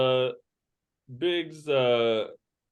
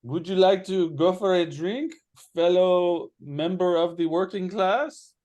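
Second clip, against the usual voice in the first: higher-pitched character voice; voiced game character; 6 semitones higher, 3 dB louder than usual. Game master voices a character inviting another for a drink, in a raised character voice.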